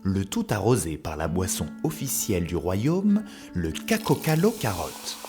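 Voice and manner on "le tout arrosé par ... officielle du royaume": usual speaking voice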